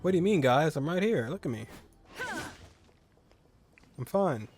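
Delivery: usual speaking voice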